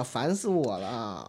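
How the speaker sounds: fed-up groan. Real, not acted.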